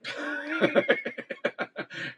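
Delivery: breathy, high laugh at himself; sheepish laugh at his own forgetfulness; airy laugh. Real, not acted. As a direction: let out a rising, airy laugh.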